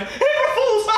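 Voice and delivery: high, mocking shout at himself in the mirror; mock self-prank. Telling friends how he pranked his own reflection, mock shout. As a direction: yell it at your own reflection. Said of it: "looked myself in the mirror and went".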